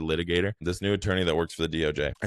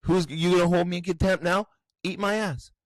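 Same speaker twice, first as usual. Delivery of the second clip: much higher, taunting mock voice; mock lawyer tantrum; 10 semitones higher than usual. Lawyer taunting the judge about contempt, high mock taunt. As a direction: taunt the judge in a shrill caricature. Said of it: "And he's like".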